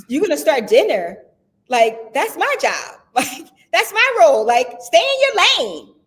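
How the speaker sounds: high, loud mock indignation at her husband; retold mad outburst. Wife retelling her outburst at husband starting dinner, mock indignant. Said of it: "And I got so mad".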